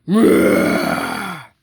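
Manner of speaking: deep, loud playful roar; lion roar. Pretend. Reader voicing the lions for kids, deep loud mock roar. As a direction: roar like a big friendly lion. Animal sounds in picture book.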